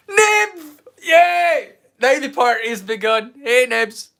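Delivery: high, loud, whooping welcome; excited greeting. Real, not acted. Streamer hails Nibs joining the party, excited and loud. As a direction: cheer a friend's arrival loudly, pitched right up.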